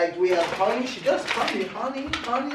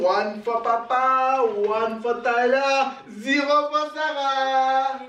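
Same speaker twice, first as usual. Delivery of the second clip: slow sing-song counting; slower than usual. Real, not acted. Counting hot dogs out.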